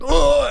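high, loud groan of alarm; exasperated groan. Retold or imitated. Driver hearing his phone dial emergency, exasperated. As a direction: groan out in mock panic.

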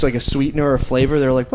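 ordinary delivery

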